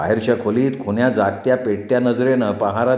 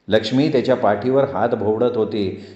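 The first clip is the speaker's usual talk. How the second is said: ordinary delivery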